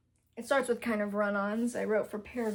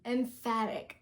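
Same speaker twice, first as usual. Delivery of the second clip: "emphatic" drawn out slowly; slow careful correction; slower than usual. Shown as a demonstration. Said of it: "I know what emphatic".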